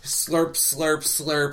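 loud wet slurp sounds; slurping noises. Retold or imitated. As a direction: slurp it out like suction. Imitating octopus slurping out drain.

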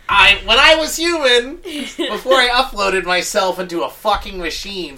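high, loud uploaded-machine voice; Mark Zuckerberg. Acted. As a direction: play a man turned machine, hammed up and loud. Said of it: "what if he just is his own personality, like, uploaded".

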